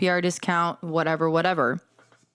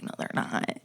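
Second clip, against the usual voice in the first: hushed retort; 8 dB quieter than usual. Retold or imitated. Bartender refusing the customer.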